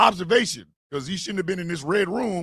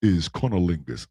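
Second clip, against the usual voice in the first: a much lower, deep mock announcer voice; mock deep announcement. Host mocks E proclaiming the word of the day in a deep voice. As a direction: announce it like a grave street preacher. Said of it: "walking on the street talking about".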